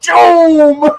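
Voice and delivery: loud, high boom with a laugh; explosive launch noise. Retold or imitated. Squirrels launch like little missiles, explosive laughing boom. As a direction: blast the word out like a missile launch.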